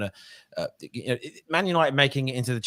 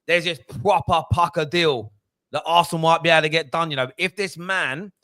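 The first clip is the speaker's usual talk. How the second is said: hammed-up fan-channel pundit, raised pitch; street-slang journalist; 4 semitones higher than usual. Fan imagining journalist breaking a transfer, hammed-up street-slang voice. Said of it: "Imagine a professional journalist talking like that".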